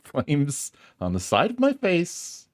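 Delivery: high, seething quote of the Clue line; Clue line impression. Quoting the best line from Clue, high and seething. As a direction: recite it like the burning widow in Clue. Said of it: "the best line read in Clue".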